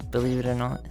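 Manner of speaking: normal talking voice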